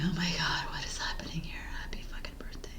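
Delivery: unvoiced whisper under breath. Real, not acted. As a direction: hiss it to yourself. Muttering after blowing the take.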